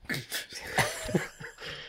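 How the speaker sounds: breathy giggle. Real, not acted. Laughs after being teased.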